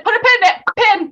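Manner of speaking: lifted, playful quote of their own catchphrase; playful callback. Retold or imitated. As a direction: sing it out like a running joke. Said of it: "the scene where we said".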